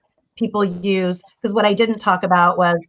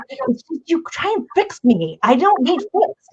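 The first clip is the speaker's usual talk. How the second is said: annoyed son; 8 semitones higher than usual. Retold or imitated. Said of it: "he goes".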